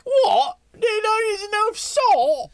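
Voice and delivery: high, loud and outraged; shocked disbelief. Real, not acted. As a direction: cry out in disbelief. Shocked disbelief about salt.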